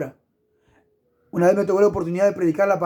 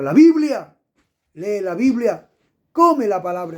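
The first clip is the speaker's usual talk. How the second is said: shouted proclamation; 2 semitones higher, 4 dB louder than usual. Acted. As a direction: shout the name of the book like a revelation. Preacher proclaims the true prophecy. (proclamación gritada, mucho más alta y fuerte)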